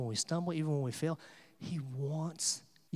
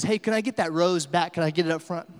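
raised voice to a crowd; calling out; 6 dB louder than usual. Retold or imitated. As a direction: imitate a preacher calling to the back of the room. Preacher calls out to the crowd.

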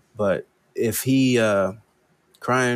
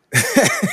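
burst of laughter; 13 semitones higher than usual. Real, not acted. Laugh before the quote.

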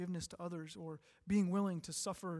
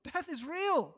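tearing up; 8 semitones higher, 4 dB louder, slower than usual. Real, not acted. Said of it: "I was tearing up".

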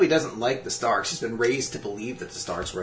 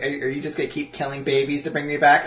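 normal talking voice

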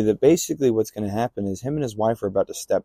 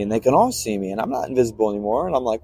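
ordinary delivery

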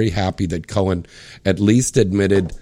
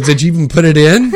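mocking put-down; 4 semitones higher, 5 dB louder than usual. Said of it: "She goes".

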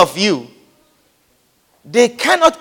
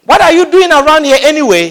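louder, higher scolding demand; angry demand; 6 semitones higher, 5 dB louder than usual. Retold or imitated. Big brother scolding David, louder angry demand. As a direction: snap it out like a scornful elder brother. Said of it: "he demanded".

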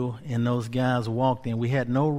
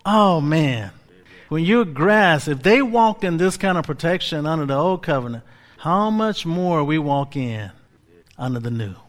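loud, rising preacher's cry; preaching at full force; 5 semitones higher, 6 dB louder than usual. Acted. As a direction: preach it hard to the congregation. Preaching to the church.

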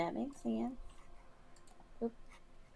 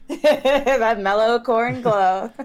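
slow, laughing drawl; 2 semitones higher, 8 dB louder, much slower than usual. Real, not acted. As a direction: savor the joke through laughter. Laughing at GM's joke.

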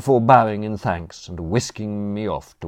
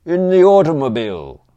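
high, loud long-distance reply; shouting back; 9 semitones higher, 10 dB louder than usual. A narrator's reading. Butler answering the don's challenge, shouting back. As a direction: shout the answer across the lawn. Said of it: "Jeeves shouted back".

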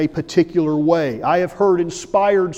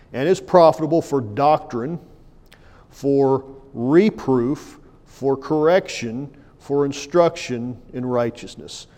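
solemn public reading of scripture; 2 semitones lower than usual. Acted. Reads King James text.

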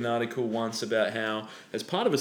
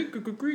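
DJ stutter edit; 13 semitones higher, 4 dB quieter than usual. Retold or imitated. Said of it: "Jive Bunny loved that sort of".

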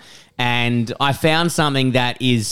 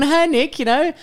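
higher, teasing sing-song jab; mock taunting; 12 semitones higher than usual. Ribbing his riding mate over the hill, teasing. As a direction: rib your mate playfully. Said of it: "rinsing you just a little bit".

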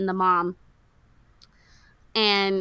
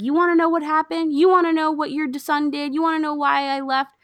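high, raised voice, question piled on question; heated confrontation; 8 semitones higher, 4 dB louder than usual. Retold or imitated. Retelling Gunga finally speaking the truth, high heated imitation. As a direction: throw the questions out in mock outrage. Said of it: "Gunga speaks the truth".

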